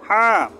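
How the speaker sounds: হা pushed out with a breath from mid-throat; breathy throat ha. Shown as a demonstration. Teacher showing students a breathy throat হা. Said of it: "গলার মধ্যখান থেকে একটু বাতাস চাপ লেগে বের হবে".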